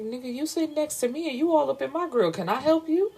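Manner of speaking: high, fast, incredulous retort. Retold or imitated. Cameron pushing back at the stranger crowding her, incredulous. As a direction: push back at a creep, half laughing.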